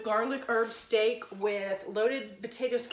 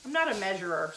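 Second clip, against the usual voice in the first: usual speaking voice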